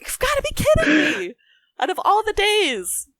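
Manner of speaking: high-pitched retold exasperation; high, incredulous mock complaint; exasperated disbelief. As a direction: replay your outrage, pitch soaring, then drawing it out. Retold exasperation at family sleeping in.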